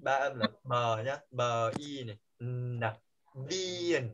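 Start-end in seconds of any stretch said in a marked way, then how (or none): 1.3-4.1 "bờ", "y", "nà" slowly apart, then blended into "bin"; three sounds separated. Shown as a demonstration. Teacher showing three sounds combine, "bin" broken down slowly. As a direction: spell out "bin" sound by sound, B, long ee, N. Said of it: "nó sẽ kết hợp với ba âm".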